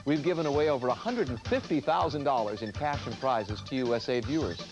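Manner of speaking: upbeat TV promo voice; announcer read. Acted. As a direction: read it like a game show promo. Game show promo read.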